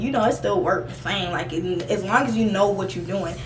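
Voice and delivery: normal talking voice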